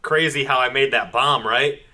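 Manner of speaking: quoted character. Retold or imitated. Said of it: "he's like".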